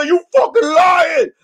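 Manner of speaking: high, loud, stretched-out retort; acted accusing yell. Kid calling out a liar, high mock yell. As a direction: holler the accusation in comic disbelief.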